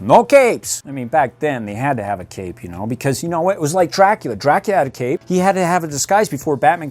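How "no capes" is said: Edna Mode quote. Retold or imitated. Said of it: "What did Edna say on Incredibles".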